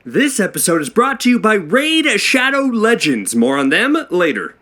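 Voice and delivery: upbeat sponsor announcer read; bright ad announcer voice. Acted. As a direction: sell it like a sponsor spot. Played ad-read intro.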